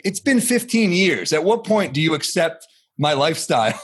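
high-pitched mock exasperation; exasperated comeback. Comedian answering family who doubt his career, exasperated.

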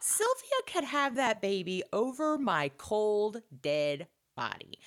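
slow, deliberate, indignant; mock outrage. Host voicing outrage at Sylvia, slow and indignant. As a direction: spell it out slowly like a vow. Retelling her own outrage.

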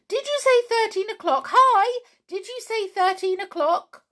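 high, loud, rapid pixie clamour; squeaky-high excited shouting; pixies shouting loudly. A narrator's reading. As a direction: cry out like a crowd of tiny pixies. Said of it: "crowd of pixies shouting loudly".

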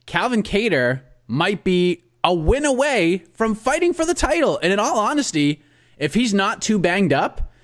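announcer intro. Acted. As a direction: open the segment like a broadcaster.

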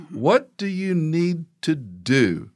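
a father drawing out a demand for an apology; stern father. Retold or imitated. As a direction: spell out each word to the son.

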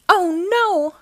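high, alarmed exclamation; dismayed cry. A narrator's reading. Uniqua sees the cage fall, dismayed cry. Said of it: "cried Uniqua".

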